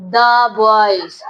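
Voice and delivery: put-on "The" as open "da" before boys; "the" as duh. Shown as a demonstration. Teacher answers how she pronounces it, "The" as da. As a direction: sound "The" as da, then boys. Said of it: "pronounce किस तरह से करूंगी?".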